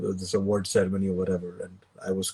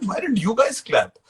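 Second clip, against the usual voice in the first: high-pitched retold protest; indignant question; 11 semitones higher than usual. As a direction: ask it as a mock-aggrieved complaint. Confronting family in a retold exchange.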